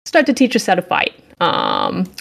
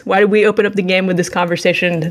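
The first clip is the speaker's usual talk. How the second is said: normal talking voice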